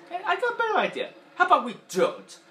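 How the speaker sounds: high, loud sarcastic toy voice; Mister Potato Head voice. Retold or imitated. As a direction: brush it off with sarcasm. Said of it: "Potato Head went like this".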